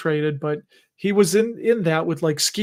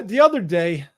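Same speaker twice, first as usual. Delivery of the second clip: ordinary talk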